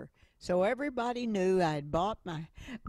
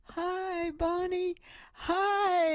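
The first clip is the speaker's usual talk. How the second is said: cheery greeting; 13 semitones higher, much slower than usual. Retold or imitated. Neighbors greeting her with smiles.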